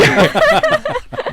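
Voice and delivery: high burst of laughter; real laughter after the story. Real, not acted. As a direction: lose it laughing. Laughing at his nan's mistake.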